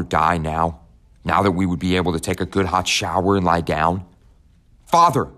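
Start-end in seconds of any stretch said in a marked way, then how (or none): 4.9-5.3 a high, loud desperate howl. Acted. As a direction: cry out to a collapsing father. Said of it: "I howled".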